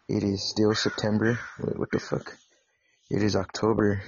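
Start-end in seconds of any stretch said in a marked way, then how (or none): 1.6-2.4 hushed double-take; whispered surprise. Real, not acted. Host noticing the wrong month, whispered surprise. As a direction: catch yourself in a startled whisper. Catching his own date error.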